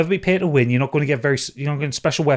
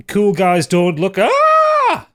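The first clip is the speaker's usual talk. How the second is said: shouted out, ending in a yelled ah; hammed-up shout; mock shout; 7 semitones higher, 5 dB louder, much slower than usual. Shouting the achievement name with explosion.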